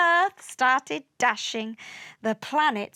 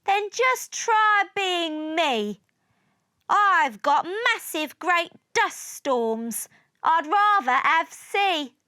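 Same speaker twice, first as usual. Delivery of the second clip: higher-pitched, heated retort; shouted complaint; 6 semitones higher, 4 dB louder than usual. A narrator's reading. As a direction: argue back, trying to outdo them. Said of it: "shouted Mars".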